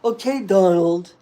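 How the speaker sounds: loud mocking Okay, Donald; hammed-up taunt at Donald; Rosie mocking Trump. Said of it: "imitate Donald Trump like that".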